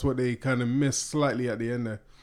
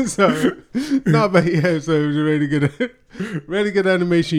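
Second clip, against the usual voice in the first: louder, higher, still giggling; laughing; 4 semitones higher, 7 dB louder than usual. Real, not acted. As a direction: talk while the laugh fades. Recovering from a laughing fit.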